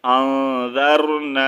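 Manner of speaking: slow recitation, nasal hold on آن; ghunna. Shown as a demonstration. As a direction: stretch آن through the nose and lengthen نا. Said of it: "غنہ کریں گے". (آن پر غنہ، آہستہ تلاوت)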